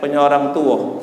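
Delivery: normal talking voice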